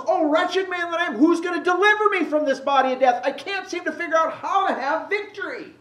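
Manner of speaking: high, anguished Paul crying out for rescue; frustrated lament. Retold or imitated. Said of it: "Paul's frustrated".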